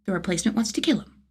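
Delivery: ordinary delivery, nothing marked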